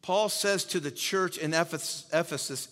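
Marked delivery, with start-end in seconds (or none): none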